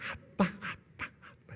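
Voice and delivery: whispered imitation of a baby; baby's first words. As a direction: mouth it softly like a baby learning to talk. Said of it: "우리 하민이는 아빠를 먼저 했어요". (말 배우는 아기 흉내, 속삭이듯)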